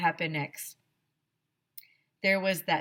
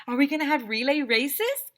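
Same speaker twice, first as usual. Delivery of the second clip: put-on eager child voice; 8 semitones higher, 6 dB louder than usual. Child Alexia asks eagerly.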